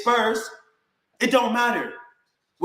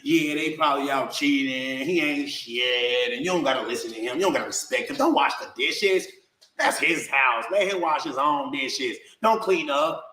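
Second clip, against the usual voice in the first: coaching mother; 5 semitones lower than usual. Retold or imitated. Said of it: "she would be coaching her daughter".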